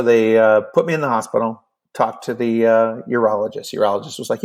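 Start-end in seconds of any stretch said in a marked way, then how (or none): none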